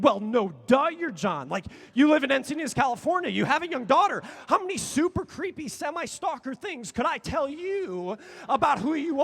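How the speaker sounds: loud and fast, tumbling out; gushing fanboy rant. Retold or imitated. Thinking at the singer as an overeager fan, loud and fast. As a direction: rattle off the fan facts breathlessly. Inner fanboy thoughts rush out.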